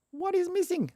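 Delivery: very high, mock-exasperated question; high-pitched puzzlement. Real, not acted. Coder asking the error what it wants, high-pitched puzzlement. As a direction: squeak it out on one high held pitch.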